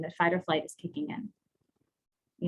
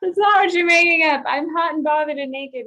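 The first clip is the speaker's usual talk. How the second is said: much higher, loud, near-crying laughing objection; laughing protest; 8 semitones higher, 7 dB louder than usual. Real, not acted. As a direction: shout your disbelief through laughter. Mock protest at co-host's answer.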